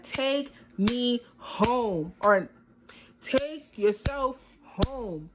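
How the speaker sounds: fed-up ultimatum. Retold or imitated. Telling her date to take her home, slow and over it. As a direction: stretch each word like you're done with him.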